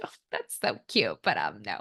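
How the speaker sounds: low, patronizing brush-off; dismissive brand rep. Retold or imitated. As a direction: pat her on the head, then say no. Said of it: "And they're like".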